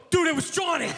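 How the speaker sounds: high, loud, urgent whisper-shout of a name; excited shout of a name; urgent blurt. Retold or imitated. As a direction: blurt it out like you just cracked the case. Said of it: "no joke, just goes".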